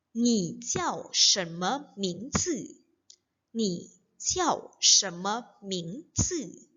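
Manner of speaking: slow, evenly separated Mandarin syllables; read-along. Shown as a demonstration. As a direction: model it slowly for learners to repeat. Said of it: "jadi kita baca bareng-bareng ya". (baca pelan agar bisa diikuti bersama)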